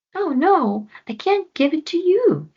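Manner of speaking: put-on high child voice, pleading no; Floki refusing. Floki refuses the panther, high child voice.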